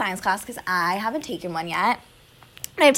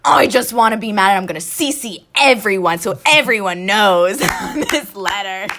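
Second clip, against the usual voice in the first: defiant; 7 dB louder than usual. Retold or imitated. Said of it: "and I was like".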